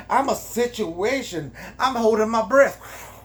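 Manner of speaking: high, breathless-with-nerves excitement; hammed-up swooning panic; giddy fluster. Heroine giddy at his proposal.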